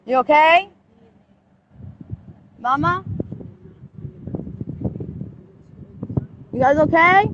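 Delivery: loud, worried calls across the street; calling out. Real, not acted. Mother calling to her children in the police car, loud and worried.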